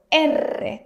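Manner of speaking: "R" said with a rolled, trilled tongue tip. Shown as a demonstration. Teacher showing the rolled R students struggle with. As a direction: roll the tongue on "R". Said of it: "It's the rolled R".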